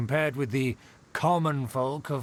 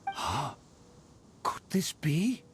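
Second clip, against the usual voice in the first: breathless whispered disbelief; slow hushed whisper of wonder; slower than usual. Acted. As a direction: whisper it in awe. Noble sees gift of food.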